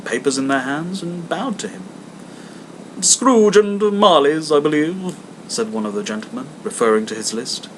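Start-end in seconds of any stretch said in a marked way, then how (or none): 3.0-5.1 higher, slow, polite gentleman; a courteous charity collector; hammed-up polite visitor; portly gentleman.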